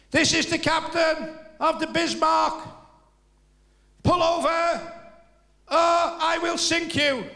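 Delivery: high, loud, very slow booming command; megaphone. Acted. Bismarck captain ordering a ship to pull over, through a megaphone.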